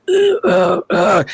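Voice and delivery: high strangled choking noises; choking gasps. Retold or imitated. Guest mimics the broadcaster choking on a cookie, strangled gasps. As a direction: imitate a man choking on a cookie. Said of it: "And he's like".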